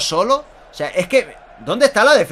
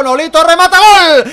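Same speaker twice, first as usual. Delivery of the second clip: loud rising goal call; goal shout; 9 semitones higher, 10 dB louder than usual. Real, not acted. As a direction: scream the finish. Goal called.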